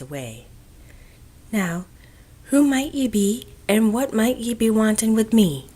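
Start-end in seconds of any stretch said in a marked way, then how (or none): 1.5-5.7 put-on higher, louder strong Irish brogue, asking the children's business; a witch's thick Irish brogue at her doorstep. A narrator's reading. Old witch greets the children at her door, thick Irish brogue. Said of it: "with an accent three times as strong as Judy's".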